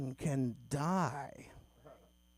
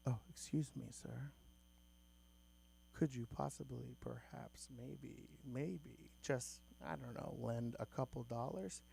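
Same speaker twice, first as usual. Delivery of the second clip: much softer, overly polite begging; very cordial; 4 semitones lower, 16 dB quieter than usual. Retold or imitated. Homeless guy politely asking the comedian for money, very soft. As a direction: ask ever so politely, hesitating. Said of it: "Very cordial".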